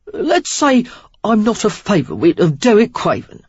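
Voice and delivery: a sly, evasive Cockney man; Cockney accent. A narrator's reading. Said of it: "Cockney in his accent".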